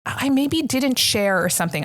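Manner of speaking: ordinary talk, nothing marked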